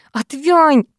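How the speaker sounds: mild growl of annoyance. A narrator's reading. Girl telling boy to back off, growling mildly. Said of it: "прорычала я".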